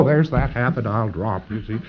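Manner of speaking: ordinary delivery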